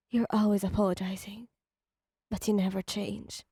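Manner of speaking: hushed, barely voiced reproach; a whispered rebuke to a partner who never changes. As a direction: whisper it, worn out. Reader answers Dazai, hurt.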